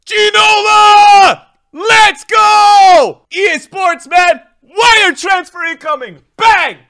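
high, full-volume celebration scream; ecstatic shouting. Real, not acted. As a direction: yell it out in triumph. Celebrates getting a wanted player.

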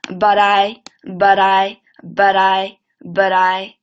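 linked but I drill; linking but I. Shown as a demonstration. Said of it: "连起来".

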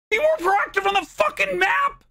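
high, loud incredulous yell of the tip; echoing the chat's tip back, enraged; outraged repeat. Real, not acted. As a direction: throw the advice back in disbelief.

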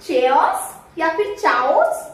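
"चेओस" and "चाओस" begun with a ch sound; common mispronunciations. Shown as a demonstration. Trainer quoting learners' guesses, mispronunciation. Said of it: "आप क्या pronounce करते हो".

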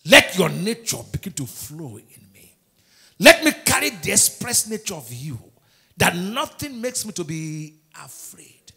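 loud, slow preacher's prayer; fervent prayer. Real, not acted. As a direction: pray it out forcefully, drawing words out. Leads a prayer of courage.

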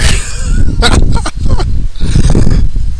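bursts out laughing, breathy and loud; can't hold back a laugh at the absurd quote; loud unvoiced laughter; real laugh. Real, not acted. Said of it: "laughs at the quote".

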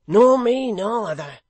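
high, loud and eager; loud cry. A narrator's reading. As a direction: cry out hungrily. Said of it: "cried Hugh".

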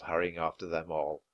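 ordinary delivery

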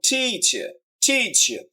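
Teacher with TEE held long; long first syllable. Shown as a demonstration. Teacher showing long means stressed, drawn out. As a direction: draw out the start of teacher, drop the end. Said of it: "long".

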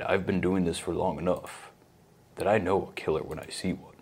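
mock super-calm podcast host; super calm narration. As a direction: narrate it softly, with no emotion. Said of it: "super calm voice".

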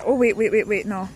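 ordinary delivery

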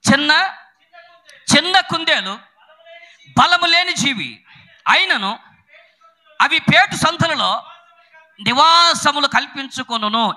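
high, slow, measured proclamation; stretched, declaimed Bible verse; scripture reading. Real, not acted. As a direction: read the verse phrase by phrase with pauses. Reads scripture aloud phrase by phrase.

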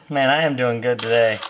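normal talking voice

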